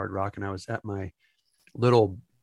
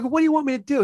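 exasperated; 13 semitones higher, 4 dB louder, faster than usual. Retold or imitated. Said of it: "I'm like".

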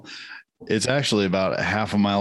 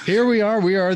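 excited; 10 semitones higher, 3 dB louder than usual. Acted. Intro restated high and loud.

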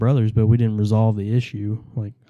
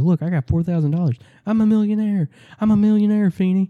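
much higher, bragging, showing off his money; boastful excitement; 8 semitones higher than usual. Retold or imitated. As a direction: brag like a kid waving cash in someone's face. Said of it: "He's like".

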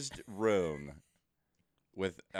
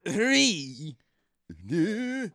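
put-on lightly shaky old man countdown; a hint of a trembling old man; 4 semitones higher, much slower than usual. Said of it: "shaky old man voice you got going on".

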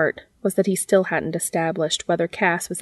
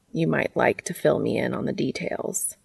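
lower-pitched, measured character voice; reserved Cass figure; 5 semitones lower, 4 dB quieter than usual. Acted. Cass asks Dean to explain, low and reserved. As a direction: ask in a low, careful voice.

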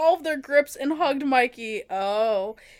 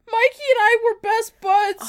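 mock crying; 7 semitones higher, 5 dB louder than usual. Dramatic emotional reading.

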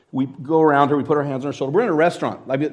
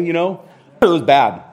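ordinary delivery

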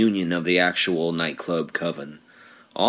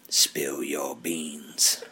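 slow whisper; 5 semitones lower, 5 dB quieter, much slower than usual. Pretend.

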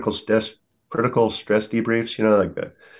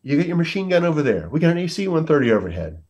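higher voice, steady and soothing; calm reassurance; 9 semitones higher than usual. Retold or imitated. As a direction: talk a panicked man down. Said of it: "He goes".

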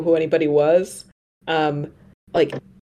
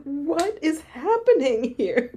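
bewildered; 9 semitones higher, 4 dB quieter, slower than usual. Retold or imitated. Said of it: "It's like".

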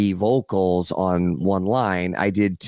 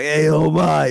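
leering catcall; 6 semitones higher, 4 dB louder, slower than usual. Retold or imitated. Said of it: "he'd be like".

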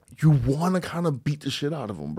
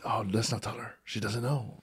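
lower, softer mock conspiratorial voice; hushed staff gossip; 5 semitones lower, 11 dB quieter than usual. Colleagues scheming to get a teacher fired, hushed and low. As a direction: murmur it like coworkers plotting behind her back. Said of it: "they were like".

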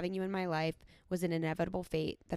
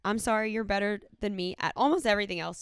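ordinary talk, nothing marked

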